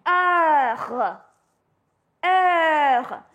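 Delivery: heure with a throaty rolled French R; French heure, R gargled at the back. Shown as a demonstration. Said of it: "and you will say".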